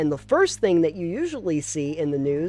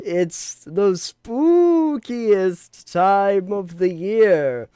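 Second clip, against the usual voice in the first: spooky announcer voice; 6 semitones higher, 7 dB louder, much slower than usual. Pretend. Spooky intro continued.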